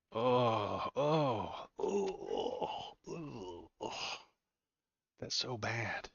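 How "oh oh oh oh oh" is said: mock anguished moaning.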